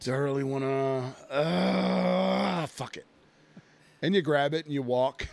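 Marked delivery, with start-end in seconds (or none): 0.0-3.1 reluctant giving in. Acted. Shopper talking himself into buying Fireball at the shelf, reluctant.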